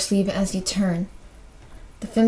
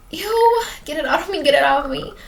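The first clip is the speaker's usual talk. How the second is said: high, loud "ew" into frantic pleading; high-pitched acted freak-out; disgusted panic; 5 semitones higher, 3 dB louder than usual. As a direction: recoil and beg in revulsion. Acted revulsion at hair in mouth.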